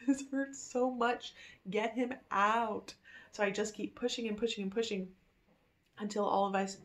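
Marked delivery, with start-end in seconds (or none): 0.1-2.9 slow, high laugh, begging to get it over; pained laughing plea. Retold or imitated. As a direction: laugh through the pain and plead. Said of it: "part of me is like".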